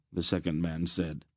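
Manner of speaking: plain reading voice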